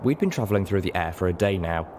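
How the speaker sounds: ordinary delivery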